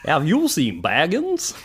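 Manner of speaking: a ring wraith asking for Baggins as Goofy; dopey cartoon voice. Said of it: "have the same accent as Goofy".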